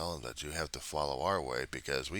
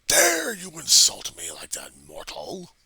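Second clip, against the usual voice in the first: megalomaniac rant; 2 semitones higher, 12 dB louder, slower than usual. Pretend. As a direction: thunder it like an offended god. Mock god-like outrage.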